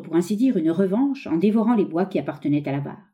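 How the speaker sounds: plain reading voice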